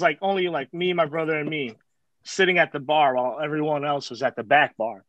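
usual speaking voice

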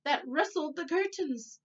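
plain reading voice

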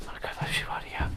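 hushed whisper. Real, not acted. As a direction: whisper in the dark. Whispering in the dark. (fısıltı)

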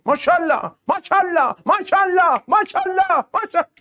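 rising praise. Real, not acted. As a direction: build the cheer up step by step. Repeated praise rising each time. (تحسین پشت سر هم با صدای رو به بالا)

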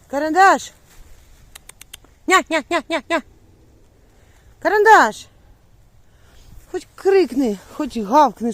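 high, loud call across the yard; calling the dog. Real, not acted. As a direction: call your dog from far away. Calling her lost dog. (голосно кличе собаку)

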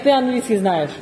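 ordinary talk

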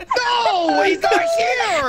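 high, drawn-out yell; shouted protest. Real, not acted. Player protesting to teammates he was misunderstood, shouting high. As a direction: yell it high, stretching the words.

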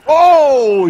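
real shock at being caught; surprised oh. Real, not acted. Preacher landing in the volunteer's arms, startled.

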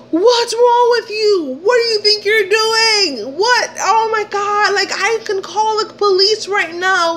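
high, loud indignant outburst; outraged confrontation. Retold or imitated. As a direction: retell it as a furious, high-pitched telling-off. Angry retold confrontation of man.

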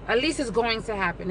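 ordinary talk, nothing marked

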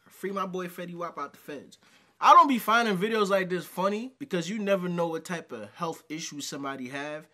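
ordinary talk

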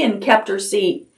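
ordinary delivery

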